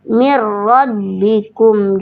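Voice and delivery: "Mir rabbikum" drawn out slowly with a long held "mir"; slow tajwid demo. Shown as a demonstration. Teacher slowly demonstrating recitation to a student, drawn out. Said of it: "tafkhim, ya, tebal".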